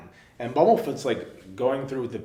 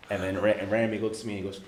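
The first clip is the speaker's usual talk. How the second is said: usual speaking voice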